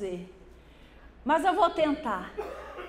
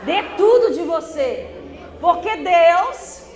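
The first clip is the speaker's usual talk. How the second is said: building to a full preaching shout, slow and louder; 4 semitones higher, 8 dB louder than usual. Real, not acted. Pastor crying out to the congregation to commit, preaching shout. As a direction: urge them to commit, climbing to a cry. Congregation told to repeat exhortation.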